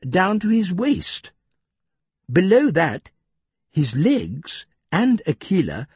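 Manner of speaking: usual speaking voice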